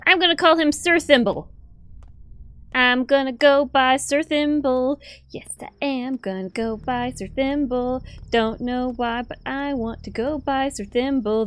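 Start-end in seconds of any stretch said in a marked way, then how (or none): 2.7-5.0 higher, eager declaration; excited announcement. Pretend. Gamer announcing his plan to buy the bull, excitedly higher. 5.3-11.5 dreamy sing-song. Pretend. Dreamy repeat of the plan.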